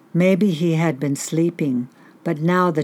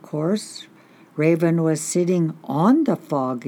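ordinary delivery

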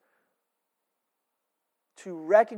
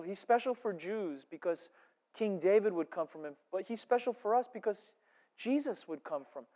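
normal talking voice

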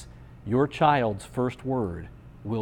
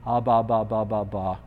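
imitated baby babble. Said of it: "to associate this sound".

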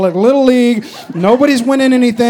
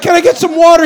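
high, loud plea for water; pleading kid; 8 semitones higher, 7 dB louder, faster than usual. Retold or imitated. Tired player begging coach for water. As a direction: beg like a worn-out kid.

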